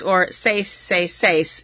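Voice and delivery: "Seis Seis Seis" said slowly in Spanish, like the announcers; Spanish pronunciation. Retold or imitated. Vlogger echoing the announcers' call all night, slow Spanish. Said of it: "as they were saying all night".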